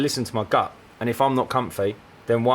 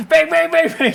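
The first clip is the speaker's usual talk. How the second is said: mock alarm dings; 5 dB louder than usual. Red flag alarm noise.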